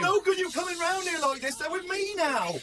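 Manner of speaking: high-pitched, heated defiance; angry confrontation. Acted. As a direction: stand up to a rival, pitched high. Confronting a rival at the door.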